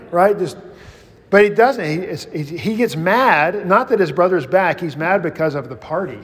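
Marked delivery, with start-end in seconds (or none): none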